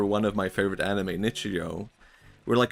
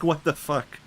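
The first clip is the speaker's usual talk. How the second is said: an incredulous, raised-pitch curse; mock outrage; 6 semitones higher than usual. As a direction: sound betrayed by bad luck. Snake eats the flower.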